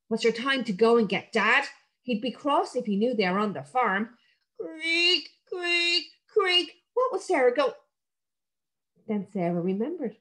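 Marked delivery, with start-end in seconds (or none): 4.6-6.8 creaking rope. A narrator's reading. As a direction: mimic an old rope creaking. Rope noise imitated.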